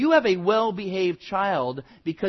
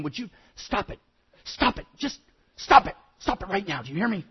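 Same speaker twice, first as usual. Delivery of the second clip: mom barking orders; 3 semitones higher, faster than usual. Retold or imitated. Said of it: "bark orders".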